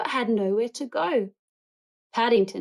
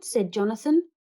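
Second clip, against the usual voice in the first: usual speaking voice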